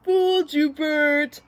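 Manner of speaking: high, loud gotcha; Ernie. A narrator's reading. Ernie owns up to the tongue trick, high and gleeful. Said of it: "said Ernie".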